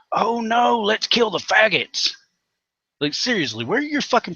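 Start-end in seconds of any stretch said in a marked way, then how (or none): 0.1-2.1 high, mock-fretful bigot voice. Vlogger mocks bigoted Christians in a high, sneering voice. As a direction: parody a hateful street preacher, pitched way up. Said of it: "you're sitting out in the streets going".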